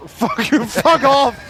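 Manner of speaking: shouting. Real, not acted. Friends trash talking over game.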